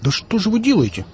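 outraged at the shock, cry it out; indignant outburst. A narrator's reading. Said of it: "возмутился он".

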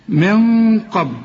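slow من قبل, a long dark hum before ق; heavy ghunna. Shown as a demonstration. Teacher shows ghunna before qaf in من قبل, long and dark. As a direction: hold a thick hum on the ن of من. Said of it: "الغنة هنا بقى مفخمة".

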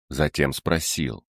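ordinary delivery, nothing marked

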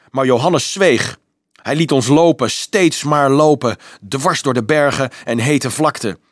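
angry, emphatic outburst; furious retort. Acted. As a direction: hammer out each accusation in indignation. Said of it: "wierp Peter woedend tegen".

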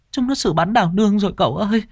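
high, fast tearful voice; sniffling. Acted. Servant girl telling the young master, high sniffling. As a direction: sniffle out the bad news. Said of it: "thút thít".

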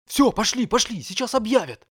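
put-on high, loud hurrying boy; urgent excited boy. A narrator's reading. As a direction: hurry them along eagerly.

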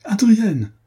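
very high, loud call; cry out. A narrator's reading. Diane to Adrienne breaking free, a high loud alarmed cry. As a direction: call her name in alarm. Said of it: "s'écria Diane".

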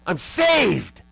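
sudden high, loud outburst; exuberant yell. Retold or imitated. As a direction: yell it out like an overwhelmed preacher. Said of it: "and yell out".

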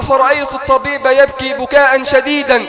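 loud, high impassioned sermon cry; preaching at full force. Acted. Preacher to congregation telling the dying youth's story, impassioned loud preaching. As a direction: pour it all out to the crowd, voice soaring.

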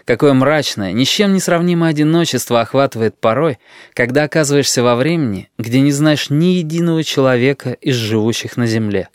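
plain reading voice

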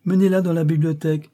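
high, loud imperious command. A narrator's reading. Young lady ordering the servant about the witch, imperious. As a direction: issue the order as if never disobeyed. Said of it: "s'écria impérieusement le bel ange".

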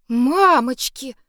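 slow, high, loud amazed gasp; awed exhale. A narrator's reading. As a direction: breathe it out in awe. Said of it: "выдохнула впечатлившаяся Саша". (изумлённый выдох)

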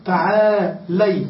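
drawn-out tutorial call, very slow; slow demo. Shown as a demonstration. Teacher models the imperative for a woman, slowly drawn out. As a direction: stretch it like a teacher calling a woman. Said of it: "إذا أردت أن تنادي امرأة تقول".

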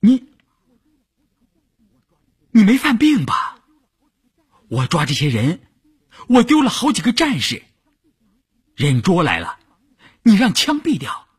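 high-pitched, alarmed protest; startled. A narrator's reading. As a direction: blurt it out aghast, voice jumping up high. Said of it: "吓了一跳". (吓了一跳，高声惊问)

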